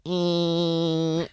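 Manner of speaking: long high-pitched car whine; imitated humming noise. Driver imitating the whine of his repaired Cherokee, high steady hum. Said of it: "it had a humming noise".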